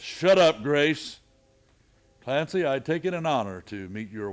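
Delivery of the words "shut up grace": loud, firm shut-down; sharp command. Acted. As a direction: cut her off hard. Marshal silencing hotel keeper.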